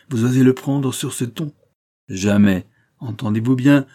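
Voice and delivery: loud, indignant rebuke; exasperated. A narrator's reading. Marquis scolds his son for daring, exasperated. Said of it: "s'écria le marquis exaspéré".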